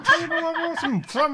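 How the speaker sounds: high, loud goofy greeting; silly voice. Pretend. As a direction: belt it in a cartoon voice. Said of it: "I've gone into a silly voice".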